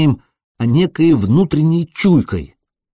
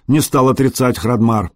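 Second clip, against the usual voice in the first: ordinary delivery, nothing marked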